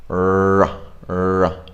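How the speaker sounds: "Rrr" held with tongue curled back, no trill; American r. Shown as a demonstration. Teacher holding the r for camera. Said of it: "this is what it looks like".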